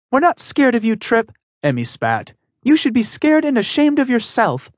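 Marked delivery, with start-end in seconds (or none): 0.1-1.3 put-on a high, firm young heroine standing up; defiant heroine voice. Emmy spat. 2.6-4.7 put-on a high, firm young heroine standing up; defiant heroine voice. Emmy spat.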